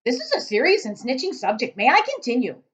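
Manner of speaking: loud, high angry giant; shouting giant. Acted. Giant scolding Sophie, loud shout.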